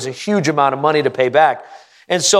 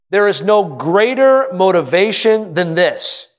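usual speaking voice

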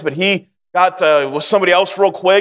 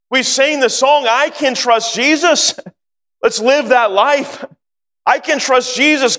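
higher, insistent preaching voice, slowing on key lines; 5 semitones higher than usual. Acted. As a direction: plead with the congregation not to resist. Preacher urges surrender to God.

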